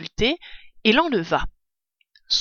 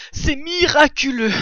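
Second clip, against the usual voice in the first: strained, raised, breath-catching awe; 7 semitones higher, 5 dB louder than usual. A narrator's reading. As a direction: marvel while recovering from a strong gulp. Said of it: "chuchota-t-il en essayant de retrouver sa respiration". (émerveillé, le souffle coupé)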